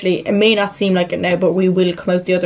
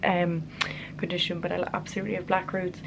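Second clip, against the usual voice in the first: hushed, softer late-night voice; quiet at night; 8 dB quieter than usual. Real, not acted. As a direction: keep it hushed so nobody wakes. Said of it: "I'm whispering because it's late".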